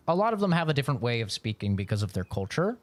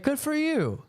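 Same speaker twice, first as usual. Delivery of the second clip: a much higher, hyped-up coworker voice; 13 semitones higher than usual. Retold or imitated. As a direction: toss it off as an impressed buddy. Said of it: "It's like".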